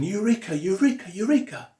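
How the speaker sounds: shouting eureka. Retold or imitated. Archimedes running down the street, mock triumphant shout.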